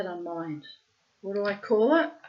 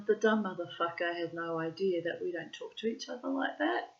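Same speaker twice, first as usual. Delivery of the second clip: plain reading voice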